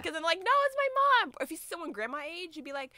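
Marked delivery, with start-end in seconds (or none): 0.4-1.3 high, louder flattered mom voice. Retold or imitated.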